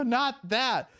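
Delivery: high-pitched cry of dismay; retold alarm. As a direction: re-enact alarm, pitch leaping way up. Knocked into water by orca.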